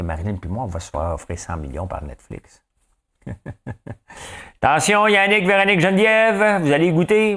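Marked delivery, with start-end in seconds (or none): 4.6-7.4 mock warning. Mock threat to named friends.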